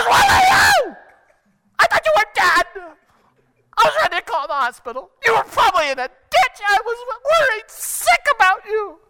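very high, screeched scolding; hysterical angry wife. Acted. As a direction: shriek at the late husband, frantic with worry. Worried wife yells at late husband.

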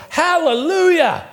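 high, slow Pentecostal shout; joyful hallelujah. Retold or imitated. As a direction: praise loudly like at a revival. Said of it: "Get Pentecostal right there".

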